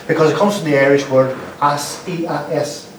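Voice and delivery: ordinary talk, nothing marked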